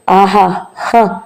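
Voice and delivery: faint, drawn-out feeble laugh; a tired, strengthless little laugh; laughing weakly through exhaustion. Acted. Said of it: "నీరసంగా నవ్వింది నిర్మల".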